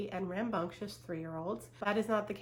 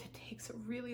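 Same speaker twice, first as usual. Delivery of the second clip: whispered comfort; 4 semitones higher, 9 dB quieter, faster than usual. Real, not acted. Comforting viewers.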